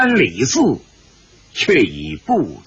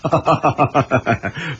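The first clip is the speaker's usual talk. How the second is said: long rippling ha-ha chuckle; hearty laugh; 2 semitones lower than usual. A narrator's reading. Zhang San answers Bai Wanjian, laughing heartily. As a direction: laugh it out in a run of ha-has. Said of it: "张三笑道".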